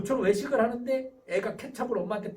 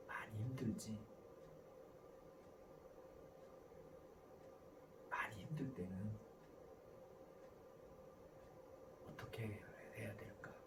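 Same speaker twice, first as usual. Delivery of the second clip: put-on soft, low, gentle parent soothing a child; 따뜻한 공감; 12 semitones lower, 12 dB quieter than usual. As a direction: comfort them tenderly, very quietly. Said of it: "자녀에게 따뜻한 마음 주기 스타일". (따뜻하게 공감하며 낮고 조용히)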